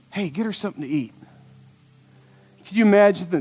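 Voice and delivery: usual speaking voice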